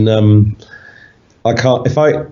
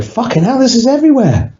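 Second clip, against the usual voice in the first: much higher, alarmed Mancunian exclamation; retold panic, pitched way up; shocked realisation; 13 semitones higher than usual. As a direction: replay the moment it all clicked, high and startled. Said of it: "I started crapping my pants because I was like".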